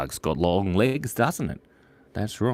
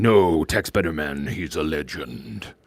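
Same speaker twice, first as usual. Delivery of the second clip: lower, slow and reverent; low reverent hush; low solemn tone; 6 semitones lower than usual. Acted. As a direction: say it like a tribute. Solemn low echo of meme.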